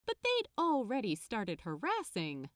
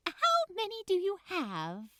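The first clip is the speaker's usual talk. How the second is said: much higher and louder, eager helper voice; enthusiastic elf; 11 semitones higher, 3 dB louder than usual. Acted. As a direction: gush the offer like a delighted elf. Elf asks Crowley.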